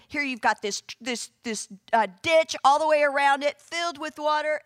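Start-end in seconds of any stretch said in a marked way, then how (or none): none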